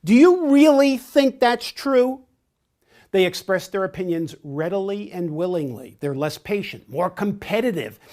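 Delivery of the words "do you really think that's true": much higher and louder, skeptical challenge; challenging question. Shown as a demonstration. Trainer voices a direct person challenging information, loud and skeptical. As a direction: push back hard on the claim. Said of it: "or to even challenge information, such as".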